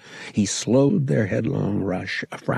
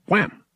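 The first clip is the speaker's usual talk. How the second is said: higher punchy wham; imitated wham; 10 semitones higher than usual. A narrator's reading. Ship crashing, imitated wham. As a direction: slam the impact word.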